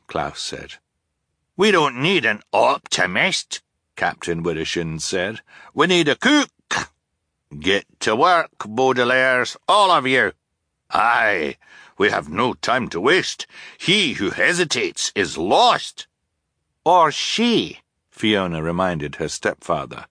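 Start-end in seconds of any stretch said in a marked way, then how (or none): 1.6-3.6 lightly voiced blustering sea captain; blustery captain. A narrator's reading. Captain Widdershins voiced by narrator. 5.7-17.8 urgent captain giving orders, a bit louder; lightly voiced commanding sea captain; blustery captain. A narrator's reading. Captain's orders in narrated story.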